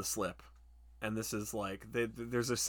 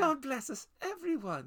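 much higher, sweet mock little boy; Tiny Tim. Mocking Danny as Tiny Tim, high piping little-boy voice. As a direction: squeak it like a saintly sickly child. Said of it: "he's got massive Tiny Tim energy".

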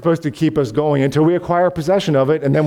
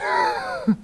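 laugh; 6 dB quieter than usual. Real, not acted. Laughing at his own joke.

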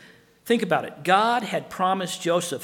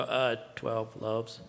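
low, slow, quiet reluctant answer; sheepish disciples; 8 semitones lower, 7 dB quieter, slower than usual. Acted. Preacher voicing disciples answering Jesus, sheepish and low. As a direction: mumble it like a slow pupil. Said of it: "And they go".

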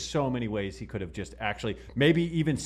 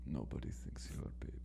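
whispered, much lower and softer mock Khabib accent; Khabib ASMR whisper; 12 semitones lower, 17 dB quieter than usual. Friend reprising the joke line in pretend Khabib ASMR, whispered. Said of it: "Khabib A S M R video".

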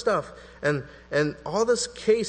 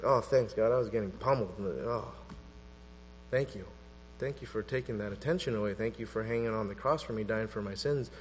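softer and lower, breathless thanks; grateful relief; 5 semitones lower, 6 dB quieter than usual. Retold or imitated. Saved sinner thanking God for taking the attention away, softly grateful. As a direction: quietly pour out thanks to God.

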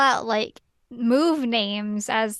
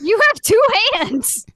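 exasperated retort; 10 semitones higher, 6 dB louder than usual. Real, not acted. Exasperated retort to retold excuse.

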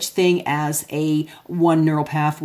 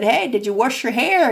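higher, light mock-suspicious mom asking her son; mock mom questioning; 6 semitones higher than usual. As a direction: play the doubtful mother checking up, pitched up. Mother questioning son, retold.

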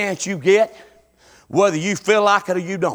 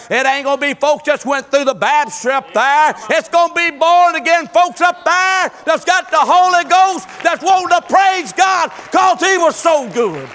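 higher and louder, shouting the key lines; preaching at full force; 10 semitones higher, 5 dB louder than usual. Acted. Preacher urging the congregation to store up praise, fiery preaching. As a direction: drive it home like a revival preacher.

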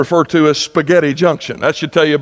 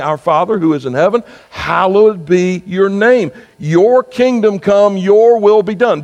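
slow, lifted prayer voice, higher and deliberate; reverent prayer; 5 semitones higher than usual. Acted. Preacher reciting the Lord's Prayer to the congregation, slow and reverent. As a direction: pray it slowly and reverently.

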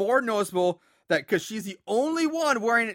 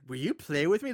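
put-on soft, eerie child-like ask; creepy twins voice; 4 semitones lower, 5 dB quieter than usual. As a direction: coax like a ghost twin. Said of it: "remind me of, like, the creepy, scary twins from The Shining".

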